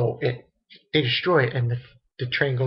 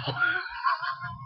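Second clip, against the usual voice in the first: high squealing burst of laughter; shrieking laugh; 7 dB quieter than usual. Real, not acted. Cracking up at the scene.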